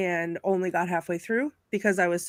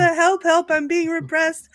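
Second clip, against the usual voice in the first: high, loud help help; high mock cry of oppression; mock yell; 8 semitones higher, 4 dB louder, slower than usual. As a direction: whine it as the put-upon peasant. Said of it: "But the".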